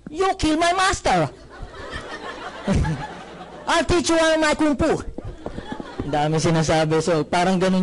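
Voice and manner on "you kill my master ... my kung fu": mock a badly dubbed Chinese movie master; dubbed kung fu movie master. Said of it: "napapanood niyo yung Chinese movie". (ginagayang boses ng kung fu master sa pelikula, pabiro)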